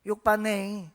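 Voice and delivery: drawn-out Jeolla dialect phrase. Shown as a demonstration. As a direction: say it as Jeolla locals would. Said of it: "전라도 말로". (전라도 사투리로)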